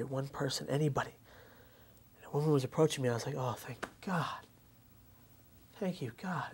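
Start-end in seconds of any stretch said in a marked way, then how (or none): 3.4-6.5 slow breathless whisper of thanks; barely voiced, as if praying under his breath; softer whispered thanks to God; relieved whisper. Relief as woman approaches.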